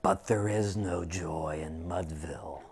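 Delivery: unvoiced, mournful whisper; grieving whisper of defeat; sorrowful whisper. A narrator's reading. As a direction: let the heartbreak hush the room. Tragic final line of the poem.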